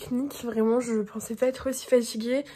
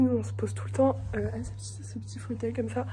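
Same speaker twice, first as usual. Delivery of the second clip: hushed whisper in a hallway; 7 dB quieter than usual. Real, not acted. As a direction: keep it to a whisper. Talking quietly at school break. (chuchoté dans le couloir)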